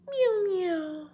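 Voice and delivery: very high squeaky meow, sung out; high cat meow. Pretend. Calling the cat in a very high meow. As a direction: call the kitty with a high meow.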